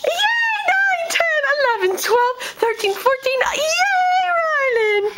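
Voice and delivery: high, near-crying count and cheer; excited tearful cheer. Real, not acted. Mother counting baby's steps, tearful and high. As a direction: cheer every step, choked with joy.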